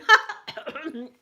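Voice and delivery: loud snorting laugh demonstrated; burst of laughter. Shown as a demonstration. Showing family how she cracked up at the funeral, loud laugh. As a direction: let a stifled laugh burst out. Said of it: "like that".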